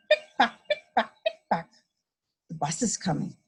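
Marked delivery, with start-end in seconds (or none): 0.1-1.7 high, laughing pick pock; high heel clicks. A narrator's reading. Lady in high heels walking, high clicks. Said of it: "The lady's high heels go".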